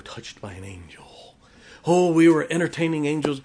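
hushed, breathy mock wonder; mock-awed whisper. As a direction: whisper it as if awestruck, tongue in cheek. Mocking awe over angels.

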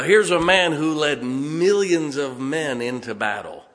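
ordinary talk, nothing marked

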